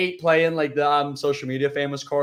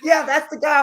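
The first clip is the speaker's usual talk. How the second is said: put-on high, eager old-man voice; excited old man; 13 semitones higher, 4 dB louder than usual. Old friend confirming the video guy, high and eager. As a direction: point him out with eager delight. Voicing elderly churchgoer.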